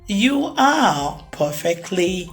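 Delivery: usual speaking voice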